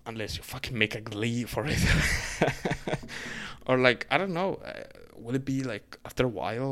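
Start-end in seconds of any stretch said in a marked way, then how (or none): 1.8-3.6 a short airy burst of laughter; breathy laugh. Real, not acted. Vlogger laughing at his own Glee joke, breathy laugh. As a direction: laugh it off, unvoiced and light.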